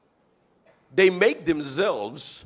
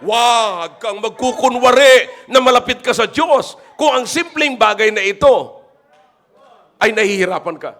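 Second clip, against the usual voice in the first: louder, higher fervent shout; preaching shout; 7 semitones higher, 11 dB louder than usual. Real, not acted. Pastor warning the church against pretending, full shout. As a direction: rebuke them at full voice.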